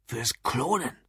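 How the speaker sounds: earnest, softly stated answer; dead serious. A narrator's reading. Drunk customer gravely tells the barman his interest, dead serious. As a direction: say it gravely, with quiet weight. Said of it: "Lou wurde wieder ernst".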